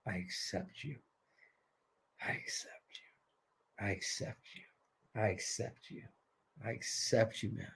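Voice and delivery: very soft, low, near-whispered repetition; hushed mantra. Shown as a demonstration. Coach leading the group in self-acceptance, hushed. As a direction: breathe it out as a private chant.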